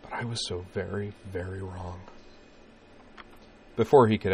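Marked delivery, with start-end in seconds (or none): 0.0-2.0 hushed, halting admission; quiet regret. A narrator's reading. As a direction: let it sink very soft by the end. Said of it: "she shivered slightly in his arms".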